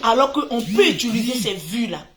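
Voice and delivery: ordinary talk, nothing marked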